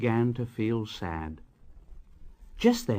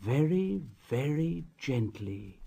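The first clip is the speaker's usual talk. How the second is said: softer, slower, tender; gently softened; 7 dB quieter than usual. A narrator's reading. Narrator showing Edward's care, softer and slower. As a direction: ease the words down gently.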